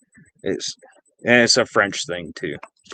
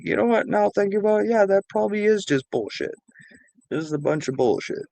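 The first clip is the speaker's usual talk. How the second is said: high-pitched mock female voice; imitating wife; 11 semitones higher than usual. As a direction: imitate her in a much higher voice. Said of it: "she's like".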